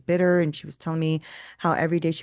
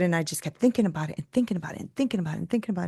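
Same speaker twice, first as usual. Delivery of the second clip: rushed; 3 dB quieter, much faster than usual. Retold or imitated. Rushed repetition of obsessive thought.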